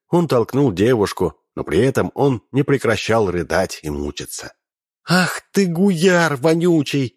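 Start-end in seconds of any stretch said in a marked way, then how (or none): 5.1-7.1 high, slow outraged cry; furious wail. A narrator's reading. Princess wailing at Razin before slapping him, high outraged cry. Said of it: "возопила княжна".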